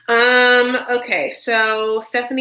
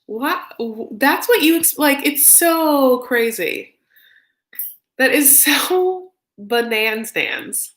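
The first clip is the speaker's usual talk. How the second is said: disbelief; 5 semitones higher, slower than usual. Real, not acted. Disbelief at cheating news.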